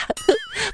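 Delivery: high, quick burst of laughter. Real, not acted. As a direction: crack up at the joke. Host laughs at the punchline. (突然爆笑)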